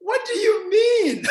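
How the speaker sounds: high, drawn-out shout of disbelief; incredulous retort. Retold or imitated. Answering a question he finds absurd, incredulous.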